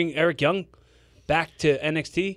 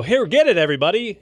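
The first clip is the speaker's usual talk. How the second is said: mock announcement; 4 semitones higher, 7 dB louder, faster than usual. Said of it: "and go".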